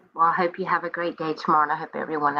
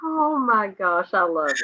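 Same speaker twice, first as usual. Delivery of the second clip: ordinary talk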